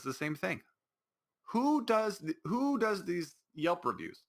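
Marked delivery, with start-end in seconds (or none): 1.5-4.2 high, slow disbelief; who would even do that, in disbelief; baffled that anyone writes reviews; incredulous. Real, not acted. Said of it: "incredulous question".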